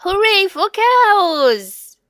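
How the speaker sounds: high, loud, drawn-out hooray; cheering child. Acted. Max cheering for the cows, high and loud. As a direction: cheer like a happy little boy.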